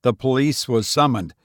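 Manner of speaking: ordinary delivery, nothing marked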